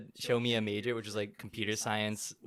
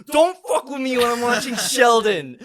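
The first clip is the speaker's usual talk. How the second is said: high, loud, slow mock shout; mom snapping; 10 semitones higher, 13 dB louder, slower than usual. Imitating mom yelling at dad, high slow mock shout. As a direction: yell it like a mom defending her show. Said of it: "And she's like".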